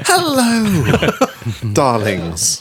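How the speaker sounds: high, laughing luvvie greeting; campy drag queen. Pretend. Mock campy greeting to listeners.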